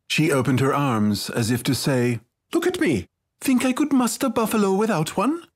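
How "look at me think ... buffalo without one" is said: put-on very high, incredulous child; scoffing girl. A narrator's reading. Small girl showing herself to her friend, scoffing and high. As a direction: point out the obvious in a girlish voice.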